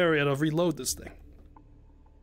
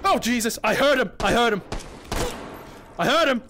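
excited shout; 6 semitones higher than usual. Real, not acted. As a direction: shout it out, thrilled. Sudden kill, hyped reaction.

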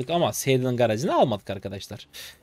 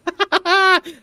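high, loud burst of gloating laughter; 7 dB louder than usual. Real, not acted. Streamer laughs at the enemy he just killed, gloating. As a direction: crack up over the kill.

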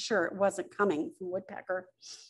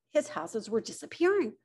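plain reading voice

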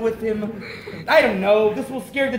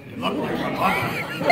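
usual speaking voice

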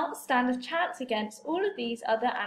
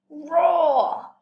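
playful roar; 2 semitones higher, 6 dB louder than usual. Acted. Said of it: "there was a terrible roar".

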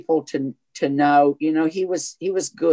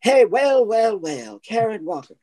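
lightly voiced catty socialite greeting, higher; Beverly Leslie; 7 semitones higher than usual. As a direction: purr a sly high greeting to a rival. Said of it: "Oh, Beverly Leslie on Will and Grace".

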